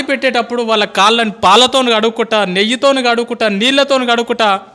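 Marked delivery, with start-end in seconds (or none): none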